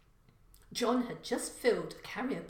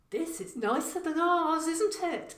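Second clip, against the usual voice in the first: put-on 1 young son, impressed; 9 semitones higher, 7 dB louder than usual. A narrator's reading. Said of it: "Cian said".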